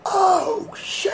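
retold shock. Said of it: "I was like".

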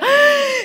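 high, drawn-in mock-shocked gasp; horrified gasp. Pastor imagining entering mid-sermon, acted horrified gasp. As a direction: suck in air, aghast.

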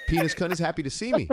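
put-on high, quick, giggly baby talk; cutesy baby voice. Gushing over a cute creature in baby talk. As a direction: squeal it like a cooing fan.

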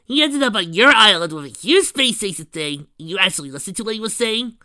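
loud, high-pitched outraged disbelief; high, indignant outburst; incredulous shout. Acted. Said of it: "Rainbow Dash immediately shouted".

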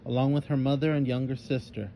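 ordinary delivery, nothing marked

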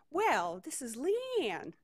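high, slow Southern drawl; amateur Southern belle impression; Leanne's Southern accent. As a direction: stretch it out in a put-on Southern voice. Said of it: "try to do a Southern accent".

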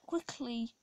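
ordinary delivery

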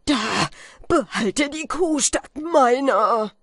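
hoch, laut, langsam; verzweifelter Schrei. A narrator's reading. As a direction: schrei es mit dem Messer in der Hand. Falke droht sich zu erstechen. (Falke schreit verzweifelt, bereit sich zu erstechen)

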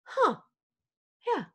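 lightly voiced baby catching on; small, high "huh" of a toddler; mock toddler pausing mid-cry; imitated toddler. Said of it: "And the baby goes".

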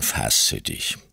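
stern man's voice. Acted. Stepfather calms the mother.

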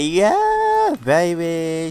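a squeaky, silly mock-flirty line; flirty sim voice. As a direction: voice the sim teasing her partner. Voicing sim's flirty reply.

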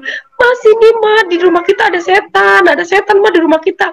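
high, urgent frightened pleading; panicked plea. Retold or imitated. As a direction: beg mom to believe there's a ghost. Panicked telling mom about ghost.

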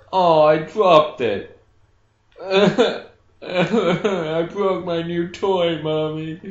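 put-on a little kid lamenting a broken toy; whiny kid voice. Child whining about broken toy.

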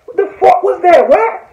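high, incredulous what the fuck; disbelieving outburst. Real, not acted. Reacting to [name]'s words about [name], high and incredulous. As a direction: jump way up in disbelief.

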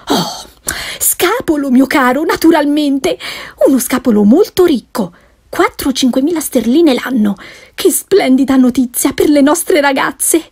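excited wife bursting with gossip; eager gossiping wife. Acted. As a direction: rattle off the news, speeding at the end. Wife delighted by rich bachelor.

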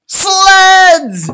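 high, loud cry of sleds; excited cry. Acted. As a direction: shout it with glee.

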